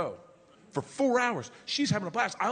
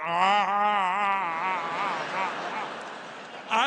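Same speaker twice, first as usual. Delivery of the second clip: involuntary walrus-like cry; much slower than usual. Retold or imitated. Dad reacts to the drink order, involuntary cry. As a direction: blurt a drawn-out cry, then stuttered ahs. Imitates own involuntary noise.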